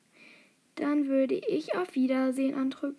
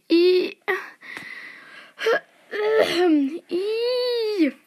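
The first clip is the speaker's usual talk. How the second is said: loud, high drawn-out "eww"; real high-pitched yuck cry; disgusted squeal; 8 semitones higher, 5 dB louder than usual. Real, not acted. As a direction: squeal in revulsion, stretching the vowel. Disgust at Draco love plot.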